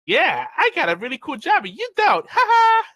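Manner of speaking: very high, loud mocking boast; mock show-off with a squealed "ha ha"; bragging. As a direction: gloat in a hammed-up taunting voice. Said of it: "bragging about it".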